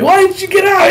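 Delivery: very high, loud imitated scolding; shrieked accusation, mimicking an angry woman; yelling, grilling. Woman grilling him in traffic, imitated high yelling. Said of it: "just starts yelling at me".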